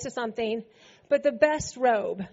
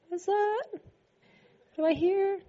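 puzzled wonder; 5 semitones higher, 4 dB quieter than usual. Acted. Older son hears the party.

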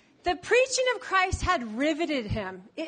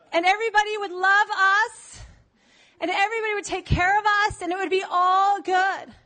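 high, complaining disappointed fan; whiny follower; 4 semitones higher than usual. Acted. Follower complaining to Jesus about the motel, high and whiny. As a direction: whine that this is beneath you.

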